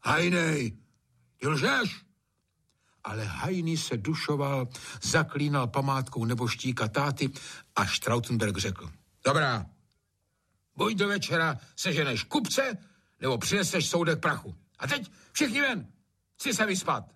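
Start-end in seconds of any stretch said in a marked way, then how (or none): 0.0-2.1 high-pitched suspicious master; raised, sharp rebuke; angry accusation. A narrator's reading. Master accuses servant of lying. 9.2-17.0 high, impatient master; raised-pitch lordly commands; angry ultimatum. A narrator's reading. Master gives ultimatum to servant.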